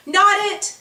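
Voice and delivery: high, loud playground holler. A narrator's reading. Girl hollers during reindeer tag, loud and high. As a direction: shout it out to dodge being it. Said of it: "Winter hollers".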